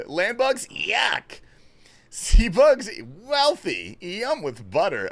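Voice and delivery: high, exaggerated exclamations; hammed-up meme delivery, pitched high; mock disgust and delight. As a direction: play up the outrage, then the relish. Acts out mock bug-eating opinions.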